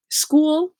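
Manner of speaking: "school" stressed as a single clear syllable; school as example. Shown as a demonstration. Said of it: "if I say".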